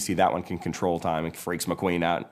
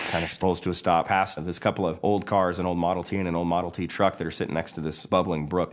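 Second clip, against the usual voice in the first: ordinary delivery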